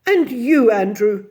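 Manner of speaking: loud, reproachful address; scolding. A narrator's reading. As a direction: turn on the pet sternly. Miss Lark scolds Andrew.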